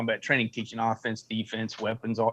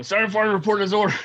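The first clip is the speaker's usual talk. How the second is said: loud, raised-pitch military report; re-enacted parade-ground report, pitched up and loud; 8 semitones higher, 5 dB louder, faster than usual. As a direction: sound off like a soldier reporting in. Acts out reporting to the captain.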